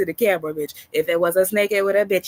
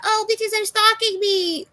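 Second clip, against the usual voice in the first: much higher, slow, put-on whiny victim voice; mocking whine. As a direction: sneer it like a fake victim. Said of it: "This time".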